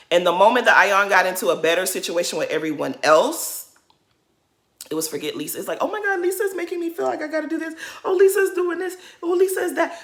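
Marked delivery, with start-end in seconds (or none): 5.8-10.0 high, fast mocking whine; mock whining. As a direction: imitate a petty complainer in a high-pitched voice. Mocking Ayan's whining about Lisa.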